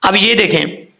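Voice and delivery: usual speaking voice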